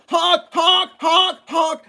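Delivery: high, loud honk honk horn imitation; honking at the old man who won't look; shouted honks. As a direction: yell honk like a car horn.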